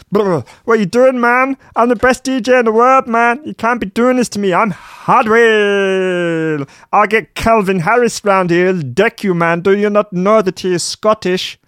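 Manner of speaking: high squeaky whiny Dutch DJ. Acted. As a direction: play a pompous squeaky superstar. Comic character voice of [name].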